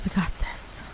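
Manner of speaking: whispered to self. Real, not acted. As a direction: breathe it out in a whisper.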